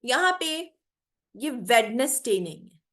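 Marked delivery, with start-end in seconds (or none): none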